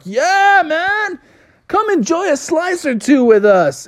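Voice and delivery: lazy friendly beach dude inviting; laid-back surfer dudes. Acted. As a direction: islanders inviting the pizza man to stay. Islanders invite him to party.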